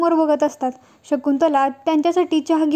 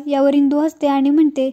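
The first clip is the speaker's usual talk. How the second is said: ordinary delivery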